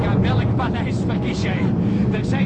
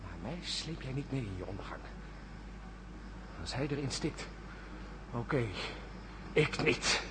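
bitter mutter; 10 semitones lower, 13 dB quieter than usual. Acted. Peter leaving, muttering bitterly.

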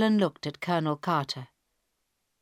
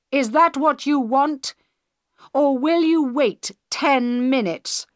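clear, slow, loud anger; 5 semitones higher, 6 dB louder than usual. A narrator's reading. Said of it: "spoke clearly, slowly and loudly ... all the anger".

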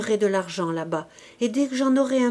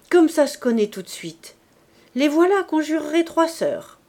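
louder, rising exclamation of a delighted mother; exclaiming; 6 semitones higher, 4 dB louder than usual. Acted. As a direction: cry it out over the three girls. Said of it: "s'écria la mère Thénardier". (s'écrier plus fort et plus haut, ravie devant les trois petites)

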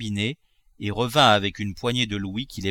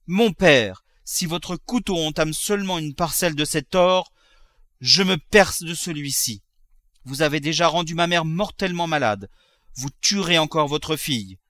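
high, intense, resolute warning; desperate threat; 6 semitones higher than usual. Acted. Daughter holding a knife to herself, desperate threat. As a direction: threaten him in earnest.

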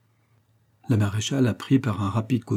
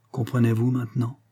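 plain reading voice

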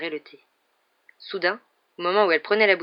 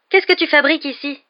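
high, loud incredulous question; raised, excited disbelief; amazed question; 9 semitones higher, 8 dB louder than usual. A narrator's reading. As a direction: demand an answer in sheer astonishment. Ron amazed to find his rat.

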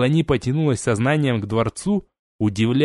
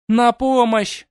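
very high, loud cry; calling for help; 12 semitones higher, 3 dB louder, much slower than usual. Acted. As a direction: scream for help. Captive princess calls help.

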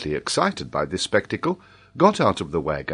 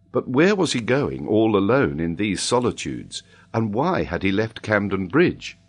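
ordinary delivery, nothing marked

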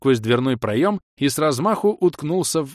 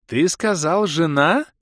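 put-on jealous girl voice; 6 semitones higher, 5 dB louder, slower than usual. A narrator's reading. Girl jealously questions knight.

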